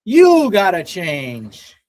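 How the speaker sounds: slow, drawn-out motivational speaker; Jim Rohn. Retold or imitated. Streamer quoting his famous mentor's best advice, slow, stretched-out delivery. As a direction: stretch each word out like a seminar speaker. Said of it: "Jim Rohn".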